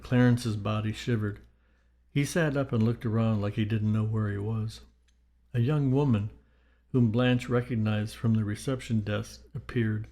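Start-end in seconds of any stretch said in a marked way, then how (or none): none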